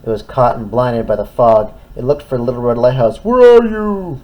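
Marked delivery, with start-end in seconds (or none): 3.2-4.2 high, loud calling into fog. Acted. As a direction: call out, lost and scared. Tug lost in fog.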